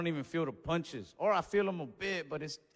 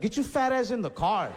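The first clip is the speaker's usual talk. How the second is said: loud, higher irritated order; mock-angry raised-voice command; mad outburst; 5 semitones higher, 5 dB louder than usual. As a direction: order the cousin off, loud and annoyed. Snaps at cousin after embarrassment.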